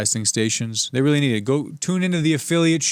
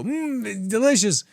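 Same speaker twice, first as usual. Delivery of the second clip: husband voice; 10 semitones higher than usual. Acted. Husband praising wife's pot roast.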